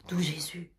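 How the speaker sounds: hushed "D'où Jésus" under the breath; whispered aside. Acted. Muttered aside.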